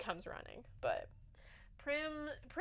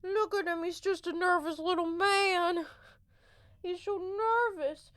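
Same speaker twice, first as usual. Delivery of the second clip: pet talk; 5 semitones higher than usual. Real, not acted. Cooing over the nervous cat.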